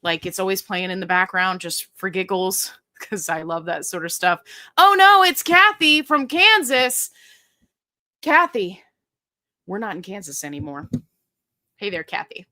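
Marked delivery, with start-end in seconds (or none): 4.8-8.8 mock alarm. Real, not acted. Greeting a viewer with mock alarm.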